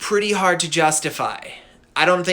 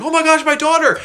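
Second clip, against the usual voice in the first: high, loud hammed-up panic; panicked father; 13 semitones higher, 7 dB louder than usual. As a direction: cry out as a horrified dad, overacted. Father sees daughter burning.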